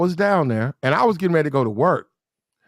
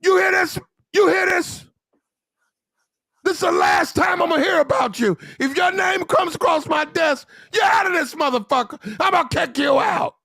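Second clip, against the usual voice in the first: mock officer yelling; 12 semitones higher than usual. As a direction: bellow at the sailor like a furious lieutenant. Lieutenant shouting at sailor.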